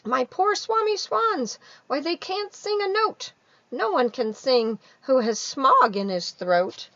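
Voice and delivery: high, loud complaining creature voice; querulous Lorax. Acted. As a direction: scold the Onceler, whining about the smog. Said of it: "he cried with a querulous croak".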